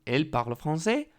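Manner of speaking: "Elle parle français" asked with pitch climbing high on "français"; rising question intonation. Shown as a demonstration. (entonación de pregunta, subiendo en "français")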